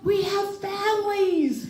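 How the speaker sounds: high, slow hammed-up plea; mock yell. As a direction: cry it out like a desperate hostage. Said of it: "he yelled out".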